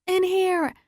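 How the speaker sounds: high, urgent yell to the police. Acted. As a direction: direct the officers to you. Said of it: "I yell".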